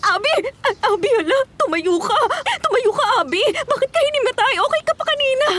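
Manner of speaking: high-pitched frantic pleading; panicked. Acted. Woman tries to rouse Abi who fainted, panicked. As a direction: urge him up in rising panic. Friend has fainted, she urges him up.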